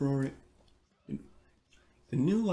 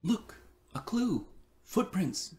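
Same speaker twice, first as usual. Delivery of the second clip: put-on child voice; 6 semitones higher than usual. A narrator's reading. Dee voiced in storybook.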